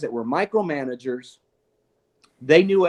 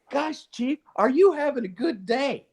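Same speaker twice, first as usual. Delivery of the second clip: much higher, sweet mock greeting; mock cheerful visitor; 10 semitones higher than usual. Officer popping into the chief's office, mock cheerful greeting. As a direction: sing it up like a fake-friendly visitor. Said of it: "and goes".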